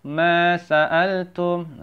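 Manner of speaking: "Ma sa'altum" chanted slowly with long ma; two-beat ma. Shown as a demonstration. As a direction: hold "Ma", then separate sa and al in "sa'altum". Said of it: "dua harokat ma-nya ya".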